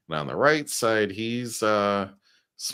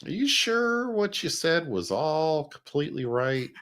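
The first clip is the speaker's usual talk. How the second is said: much higher, doubtful questioning tone; skeptical officer voice; 7 semitones higher than usual. Retold or imitated. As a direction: needle him with a doubting question. Acting out the officers' reply.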